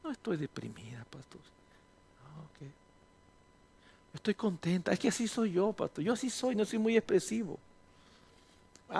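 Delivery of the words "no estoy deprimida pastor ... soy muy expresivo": barely audible, lifeless denial; quiet flat denial. Retold or imitated. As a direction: mumble that you're fine, barely there. Depressed congregant denies it. (negación apagada, casi en susurro)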